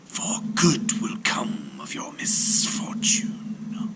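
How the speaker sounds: gentle whispered serpent; comforting serpent whisper. A narrator's reading. Serpent consoles the weeping sailor in a whisper. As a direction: soothe him in a snake's whisper. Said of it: "said the serpent".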